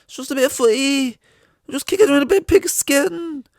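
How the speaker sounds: high mocking falsetto taunt; mocking dismissers. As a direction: squeak it out like a taunting know-it-all. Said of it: "they say".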